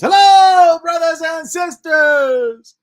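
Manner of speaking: high, slow booming preacher greeting; James Backwards impression. Imitating James Backwards hailing the brothers and sisters, high loud shout. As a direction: hail the congregation like a showman. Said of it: "the one that would always say that to me was James Backwards".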